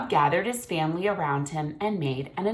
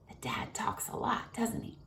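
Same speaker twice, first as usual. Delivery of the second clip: whispered aside; 4 semitones higher, 8 dB quieter than usual. Real, not acted. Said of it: "teacher's soft aside".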